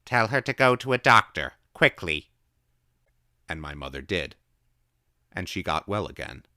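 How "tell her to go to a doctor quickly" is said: put-on high, loud old woman calling; old woman's voice. A narrator's reading. Old woman calling answers to the boy through the door.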